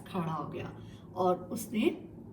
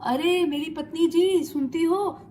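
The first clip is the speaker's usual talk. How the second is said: loud call across the house, higher pitch; cheerful call-out to his wife; 7 semitones higher, 7 dB louder than usual. Acted. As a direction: shout happily for his wife from the door. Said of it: "आवाज दी".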